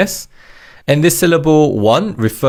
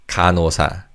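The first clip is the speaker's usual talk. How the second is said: ordinary talk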